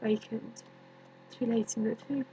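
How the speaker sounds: hushed, halting, long pauses; quiet, sorrowful recollection with space between phrases; haunted grief. Acted. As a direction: sink softly into a haunting dream. Recalls tomb, haunted by dreaming of Romeo.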